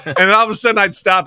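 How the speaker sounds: ordinary delivery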